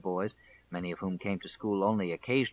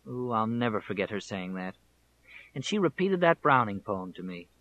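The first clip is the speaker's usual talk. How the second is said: ordinary delivery, nothing marked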